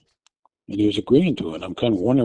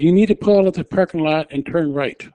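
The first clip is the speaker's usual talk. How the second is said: raised, firm direction; urgent instruction; 6 semitones higher, 3 dB louder than usual. Retold or imitated. Wife instructing her husband to drive, raised firm voice. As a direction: spell the move out to him.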